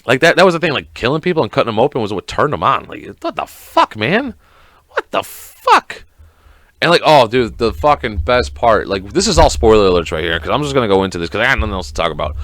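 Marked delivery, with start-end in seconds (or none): 3.2-6.0 squeaky, drawn-out incredulity; slow high squeal of disbelief; high-pitched disbelief. Real, not acted. Incredulous at what turned him on.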